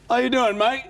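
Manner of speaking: high, loud, boozy London greeting. Retold or imitated. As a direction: hail him like a tipsy mate. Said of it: "and he went".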